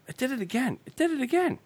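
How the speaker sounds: high-pitched, soft exclamation, climbing on the repeat; startled disbelief. Real, not acted. Host catching the watch triggering itself, high startled disbelief. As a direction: jump up high in amazed disbelief.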